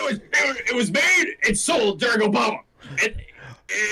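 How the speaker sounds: high, shouted conspiracy rant; Alex Jones. Pretend. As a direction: shout it like a mock alarmist host. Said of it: "start doing Alex Jones stuff".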